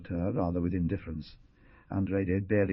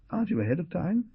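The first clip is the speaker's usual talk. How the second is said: a raised, higher bark of a question; bellowing; 10 semitones higher, faster than usual. A narrator's reading. Lawyer to the pharmacist over the clock, bellowing. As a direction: challenge him, pitched up. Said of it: "he bellowed".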